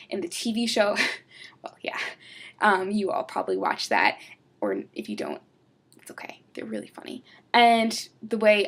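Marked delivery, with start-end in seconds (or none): 1.3-2.0 whispered aside. Real, not acted. 6.0-7.2 whispered reassurance. Real, not acted.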